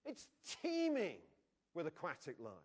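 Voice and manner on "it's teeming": teeming drawn out high and slow; stress on teeming. Shown as a demonstration. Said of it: "see the abundance and the lavishness".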